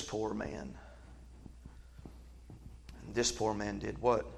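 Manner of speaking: soft, low, slow reflective hush; hushed preaching. Acted. Preacher hushing the congregation, soft and low. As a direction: pull the room in quietly.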